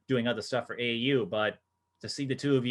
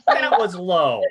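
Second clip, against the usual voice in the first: loud, high laughing protest; mock outrage; 8 semitones higher, 7 dB louder, slower than usual. Real, not acted. Host scolding teen for teasing her friend, mock outrage.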